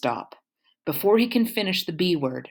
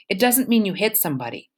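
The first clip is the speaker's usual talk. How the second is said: usual speaking voice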